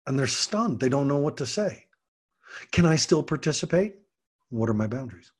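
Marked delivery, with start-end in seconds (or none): none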